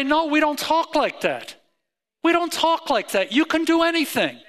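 high, loud, emphatic parental correction; insistent parent. Acted. Preacher as a parent coaching children out of defeat, insistent. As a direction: act out a parent firmly bucking up a child.

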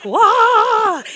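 long, high, loud drawn-out whoa; jolted by a sour taste, stretch it out high; big exaggerated "whoa"; amazed whoa. Mimics jolt of sour gum.

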